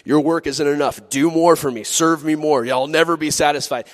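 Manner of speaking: a hint of a harsh taskmaster; demanding master. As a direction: push harder, never pleased. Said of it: "Jesus looks at you and he goes".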